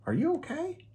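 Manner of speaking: very high worried kitten; Kitty checking on her friend; Kitty asking. Acted. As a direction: call to him gently. Kitty character voice.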